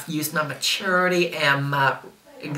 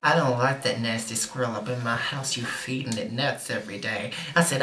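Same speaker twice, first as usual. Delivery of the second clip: ordinary talk, nothing marked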